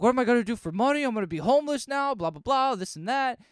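high, loud, fast panicked rant; hammed-up panic, rattled off fast; mock freak-out. Fake Wolverine losing his job, freaking out in mock panic. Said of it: "fake Wolverine starts freaking".

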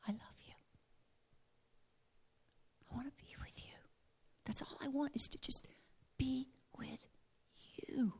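very soft, breathy whisper of love; tender whisper. Retold or imitated. The holy mind whispering its love to God. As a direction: murmur it like a secret confession.

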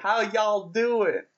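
American host attempts a Southern accent on request; mock Southern accent. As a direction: try a Southern twang, badly. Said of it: "do other American accents".

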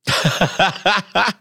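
a high burst of laughter; startled laugh. Real, not acted. Streamer laughs in disbelief when his live gets flagged. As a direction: laugh in shock at what just happened.

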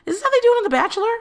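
shrill-free high incredulous ask; high exasperated question. Real, not acted. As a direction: throw the question up high in disbelief.

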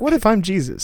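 mock Jesus musing. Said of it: "You were like".

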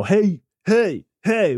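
mock hailing call; calling out hey. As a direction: hail him across the aisle. Guy calling for my attention.

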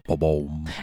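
deep mock ba-boom; low sound effect. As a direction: say it like a dramatic drumbeat. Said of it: "we always do".

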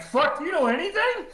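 very high, laughing imitation; laughing mock exasperation. Imitating the deputy's disbelief, laughing high. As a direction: burst it out high through laughter. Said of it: "he's looking at me like".

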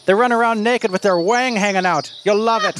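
high, lilting mock Irish brogue; delighted Irish leprechaun. Leprechaun approving a satyr disguise, delighted, in mock Irish. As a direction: play a pleased leprechaun in fake Irish. Game master plays leprechaun.

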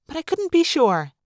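ordinary delivery, nothing marked

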